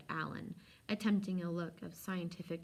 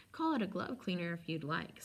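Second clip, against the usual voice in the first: usual speaking voice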